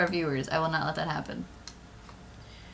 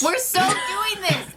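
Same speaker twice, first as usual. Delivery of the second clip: high loud slow insistence; insistent; 10 semitones higher, 4 dB louder, slower than usual. Real, not acted. Insisting over Dan.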